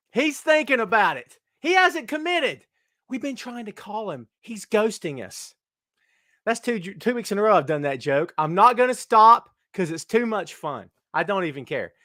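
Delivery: normal talking voice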